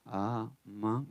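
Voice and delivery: slow hushed reading; slow and soft. Shown as a demonstration. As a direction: read it slowly, almost under the breath. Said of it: "lo leo despacio".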